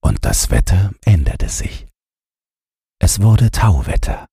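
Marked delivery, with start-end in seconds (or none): none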